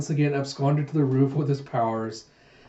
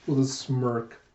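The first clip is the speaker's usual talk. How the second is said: plain reading voice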